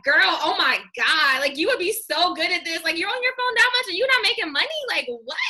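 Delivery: high-pitched, loud gushing to a new recruit; excited voice message. Retold or imitated. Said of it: "my next voice message is like".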